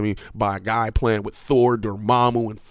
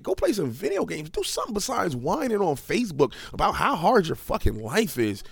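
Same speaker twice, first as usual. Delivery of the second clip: usual speaking voice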